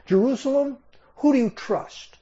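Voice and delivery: high-pitched jeering questions at the city; mocking. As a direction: taunt them, voice way up on Jerusalem. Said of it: "Now he's mocking all of them".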